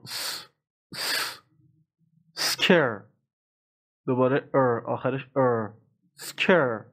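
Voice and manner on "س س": a sustained s with no vowel; bare s sound. Shown as a demonstration. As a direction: hiss a plain س with no vowel before it. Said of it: "اس نمیگم".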